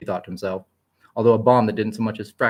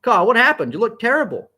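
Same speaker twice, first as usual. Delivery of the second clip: scoffing; 13 semitones higher, 7 dB louder than usual. A narrator's reading. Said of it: "he scoffed".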